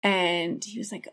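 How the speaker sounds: ordinary talk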